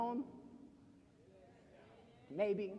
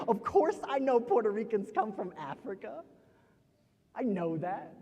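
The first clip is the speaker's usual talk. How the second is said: emphatic, defensive protest; defensive relative; 4 semitones higher, 6 dB louder than usual. Acted. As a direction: insist you already know, touchy about it. Defensive family voice in poem.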